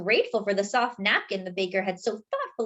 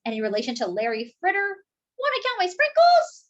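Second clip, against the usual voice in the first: higher put-on voice, peaking very high on sprinkles; flirty donut; 10 semitones higher than usual. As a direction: try out cheesy pickup lines. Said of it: "He said".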